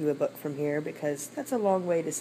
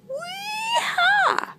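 very high, stretched monkey whoop; gleeful whooping; 3 dB louder than usual. Acted. Monkey swinging on his vine, high gleeful whoop.

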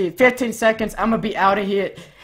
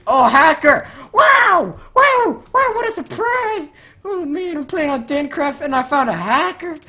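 mock surprise; 7 semitones higher, 4 dB louder than usual. Mock surprise at spotting a hacker.

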